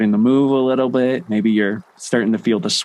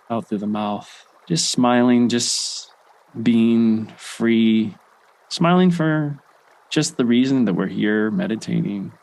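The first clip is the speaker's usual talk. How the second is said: guided breathing. Acted. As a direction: ease them through each breath, unhurried and hushed. Leads guided breathing with smiles.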